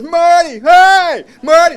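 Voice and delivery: loud, high repeated calls; shouting his name. Retold or imitated. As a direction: bellow his name over traffic. Somebody else calls across street.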